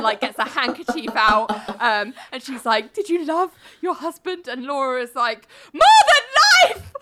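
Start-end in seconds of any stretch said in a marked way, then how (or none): 2.9-4.5 high, put-on tearful question; mock crying. Lawyer crying to sway the court, mock tearful questioning. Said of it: "she starts crying as well". 5.7-6.9 very high, loud hammed-up cry; unconvincing wail. Witness answering her lawyer about her husband, unconvincing wail. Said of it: "Nora wailing really unconvincingly".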